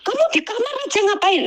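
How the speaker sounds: high-pitched imitation of an irate boss; mock angry. Maid mimics employer questioning her in the room, mock angry. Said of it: "marahnya itu bener-bener luar biasa".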